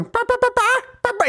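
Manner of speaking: high squawking chicken clucks; chicken noises. Retold or imitated. Old man taunting him across the street with high chicken clucks. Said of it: "making the chicken noises".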